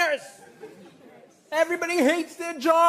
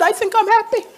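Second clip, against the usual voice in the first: meek unsure reply; 9 semitones higher, 9 dB louder than usual. Retold or imitated. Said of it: "I said".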